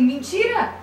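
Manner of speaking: shocked disbelief. Retold or imitated. Mother hearing her baby is cured, shocked disbelief. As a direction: blurt it out as if you can't believe it. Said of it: "eu fiquei tão em estado de choque".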